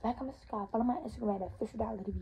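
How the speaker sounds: very soft, low sign-off; low murmured vlogger plug; hushed plug. Real, not acted. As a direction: say it quietly, almost to yourself. Same plug repeated under breath.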